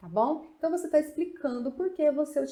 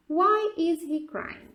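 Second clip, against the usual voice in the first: slow and a bit higher, stressing why; slow clear model; 6 semitones higher, 4 dB louder, much slower than usual. Shown as a demonstration. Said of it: "O primeiro aqui, why?". (pergunta lenta e clara)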